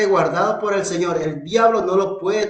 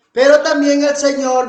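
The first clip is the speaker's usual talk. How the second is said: louder, higher preaching call; preaching outburst; 6 semitones higher, 6 dB louder than usual. Real, not acted. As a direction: lift the voice to proclaim the Lord. Predicador alza la voz. (predicador alzando la voz)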